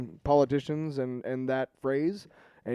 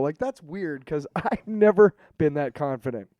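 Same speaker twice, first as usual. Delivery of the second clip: ordinary talk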